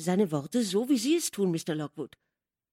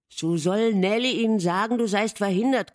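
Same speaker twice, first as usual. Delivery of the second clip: insistent, louder and higher plea; stubborn insistence; 4 semitones higher, 6 dB louder, faster than usual. A narrator's reading. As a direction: press the point stubbornly. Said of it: "meinte er hartnäckig".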